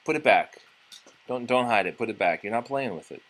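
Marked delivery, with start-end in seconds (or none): none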